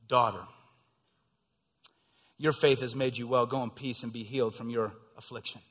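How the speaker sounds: low, soft, gentle blessing; tender Jesus. Acted. Jesus gently blessing the healed woman, low and tender. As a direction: speak to her like a loving father.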